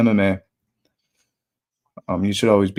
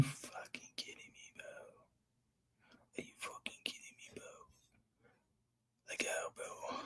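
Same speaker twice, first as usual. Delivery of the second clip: a stunned whisper; whispered disbelief; 31 dB quieter than usual. Real, not acted. Streamer after losing his parlay, stunned whisper. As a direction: whisper it, unable to believe it.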